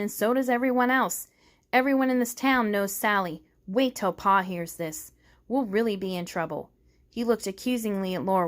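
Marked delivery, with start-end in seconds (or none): none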